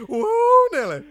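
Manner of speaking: very high, loud yokel yell; cowboy whoa Nelly. Pretend. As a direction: whoop it like a rodeo cowboy. Cowboy exclamation in hillbilly bit.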